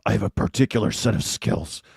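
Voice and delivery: lightly done Neeson menace; a hint of the gruff Neeson threat; Liam Neeson impression. Streamer to chat, quoting Liam Neeson with mild menace. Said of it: "Good, Liam".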